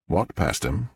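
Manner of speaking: ordinary delivery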